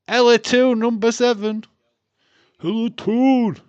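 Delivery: high, loud shout of the name; excited announcement. Real, not acted. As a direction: call her name like a stadium announcer. Cheering player in lineup.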